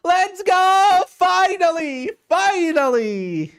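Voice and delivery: high, loud, slow drawn-out cheer; triumphant cheer. Real, not acted. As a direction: celebrate like a win. Celebrating friend's compliment.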